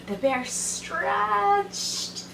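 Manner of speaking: "stretched" held long and high; drawn-out word. A narrator's reading. Narrator on the sleepy bear, "stretched" drawn right out. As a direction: stretch out "stretched" slowly like a yawn.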